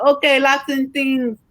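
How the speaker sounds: Lots and Things drawn out slowly after a falling Okay; a mild copy of her accent on Lots and Things; repeating her way. Retold or imitated. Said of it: "So I said".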